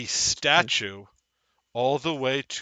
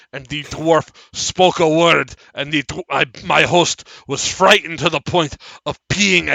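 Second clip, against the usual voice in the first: loud, slow, higher-pitched declaiming; a strange magical man's emphatic, raised voice; strange deranged man; 6 semitones higher, 14 dB louder than usual. Acted. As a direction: proclaim each word loudly and deliberately. Plays strange man recounting events.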